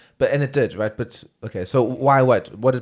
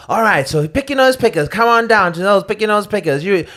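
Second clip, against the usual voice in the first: louder, higher ad announcer; commercial voice; 6 semitones higher, 5 dB louder than usual. Pretend. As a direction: sell it like a TV spot. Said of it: "I need more commercial voice".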